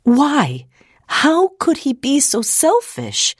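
high, outraged demand. A narrator's reading. Ida questions her father about the visitor, demanding. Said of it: "she demanded".

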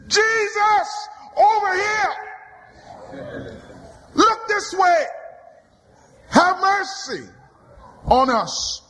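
high, desperate calling out. Acted. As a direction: wave him down from far off. Lepers calling out to Jesus.

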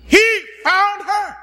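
high ringing preacher's cry; emphatic shout. Real, not acted. Preacher to congregation, a high ringing shout. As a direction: proclaim it with full force.

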